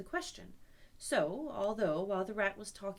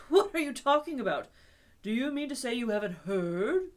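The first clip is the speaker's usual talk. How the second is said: louder, urgent question; excited; 3 semitones higher, 10 dB louder than usual. Acted. Rat amazed Toad has not heard, louder. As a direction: press him, amazed. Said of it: "greatly excited".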